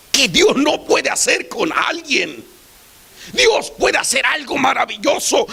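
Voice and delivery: rising sermon shout; full-force preaching. Acted. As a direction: proclaim it to the whole church.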